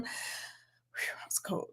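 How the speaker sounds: slow hushed whew; a whispered shiver; breathy whisper. Real, not acted. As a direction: whisper it like you're still shivering. Remarking on cold house.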